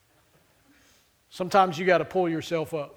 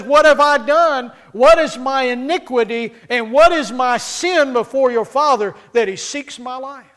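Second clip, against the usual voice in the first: pleading David; 5 semitones higher, 7 dB louder than usual. Acted. David pleads before Jonathan.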